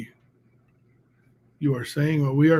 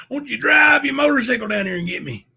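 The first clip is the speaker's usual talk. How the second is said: louder, higher shout; shouted challenge; 8 semitones higher, 5 dB louder than usual. Retold or imitated. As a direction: yell the dare at him.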